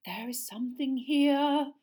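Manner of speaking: hushed, slow seer voice, voiced not breathy; a loud stage whisper of mystery; mystic whisper. A narrator's reading. Seer peering into the ball, mysterious and slow. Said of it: "Professor Trelawney whispered".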